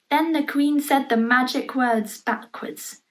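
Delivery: plain reading voice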